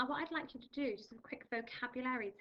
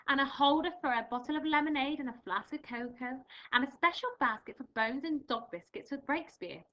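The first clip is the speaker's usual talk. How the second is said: louder, animated storybook reading; reading to children; 2 semitones higher, 5 dB louder than usual. Acted. Teacher reading Missus Armitage to pupils, animated read-aloud. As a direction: bring the story alive for young listeners.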